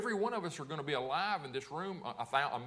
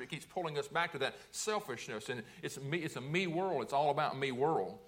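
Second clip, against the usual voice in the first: ordinary delivery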